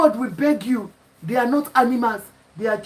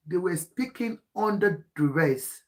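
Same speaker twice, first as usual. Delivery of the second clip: ordinary delivery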